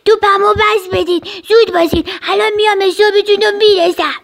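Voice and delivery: angry yell. A narrator's reading. Little rhino demanding the ball back, angry yell. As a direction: demand it back, speeding into a threat. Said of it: "اون فریاد زد".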